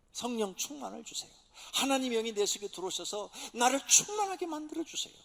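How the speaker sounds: pleading prayer, whisper swelling to loud; humble plea that builds; earnest prayer. Acted. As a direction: beg God from a whisper up to a cry. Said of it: "간절히 하나님 앞에 기도하는".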